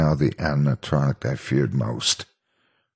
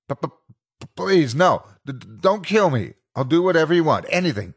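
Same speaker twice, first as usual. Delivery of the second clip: high, stuttering, terrified begging; stammering plea; 13 semitones higher, faster than usual. A narrator's reading. Cornered narrator begging Foxy not to kill him, terrified. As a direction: beg for your life through a stammer.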